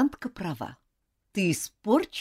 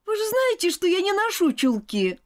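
put-on high indignant child; 14 semitones higher, 3 dB louder than usual. A narrator's reading. Girl protests about stockings.

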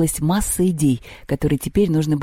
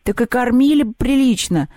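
grumbling; 8 semitones higher than usual. A narrator's reading. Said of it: "забухтели гимназисты".

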